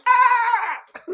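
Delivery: high, loud mimicked "Ah!"; imitated shout. Reactor mimicking Darlington's reaction to the analysis, shouting. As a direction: throw out a sudden high-pitched yell. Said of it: "Darlington was shouting".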